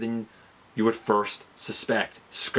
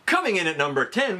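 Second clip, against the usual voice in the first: usual speaking voice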